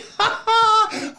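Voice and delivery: a burst of high-pitched giggling; high giggle. Real, not acted. Host cracking up at his cookie joke, high giggle. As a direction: giggle high at your own pun.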